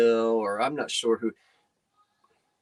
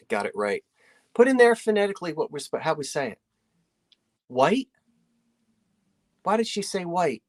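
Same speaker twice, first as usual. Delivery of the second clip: ordinary delivery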